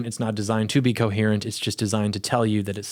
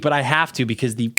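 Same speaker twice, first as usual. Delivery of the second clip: ordinary talk, nothing marked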